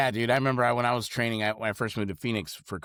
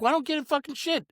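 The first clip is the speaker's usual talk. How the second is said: incredulous squeak. Retold or imitated. Mocking his own retort at work.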